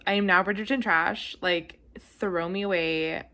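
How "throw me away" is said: ordinary delivery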